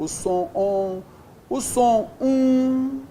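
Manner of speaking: "on" and "um" drawn out on level notes; nasal vowel sounds. Shown as a demonstration. Teacher showing digraph sounds, "on" and "um" slow and held. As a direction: hold "on" and "um" as long nasal vowels.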